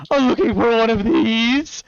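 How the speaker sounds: put-on spry old man. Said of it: "you hear from beside you".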